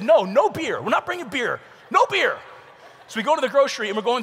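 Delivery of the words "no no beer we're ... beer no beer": firm dad. Retold or imitated. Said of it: "And I said".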